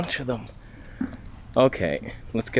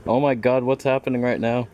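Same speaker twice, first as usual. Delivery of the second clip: ordinary talk, nothing marked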